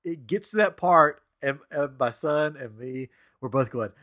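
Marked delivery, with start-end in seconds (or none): none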